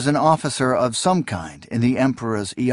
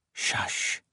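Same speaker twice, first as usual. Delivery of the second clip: hushing whisper; 10 dB quieter than usual. Acted. Hushing the dying king.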